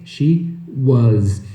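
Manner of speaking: SHE WAS low, as "shee wuhz"; correct pronunciation. Shown as a demonstration. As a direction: separate SHE and WAS, buzz the Z. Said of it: "vai sair bonito".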